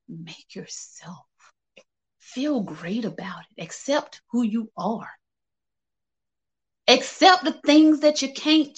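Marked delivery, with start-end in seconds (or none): 0.1-5.1 a soft breathy whisper, close to the mic. Real, not acted. Host whispers her advice to the viewers, whispered. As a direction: whisper it as a private secret.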